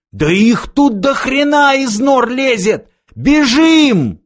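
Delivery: very high, drawn-out alarmed shout; frantic yell. A narrator's reading. Fighter warns comrades of creatures swarming from burrows, yelling frantically. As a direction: yell wildly, rising to a loud shout on the last word. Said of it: "вдруг заорал дурным голосом".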